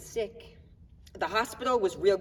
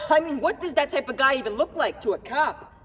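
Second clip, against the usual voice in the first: indignant challenge; 6 semitones higher, 6 dB louder than usual. Acted. Challenging the police questioning him.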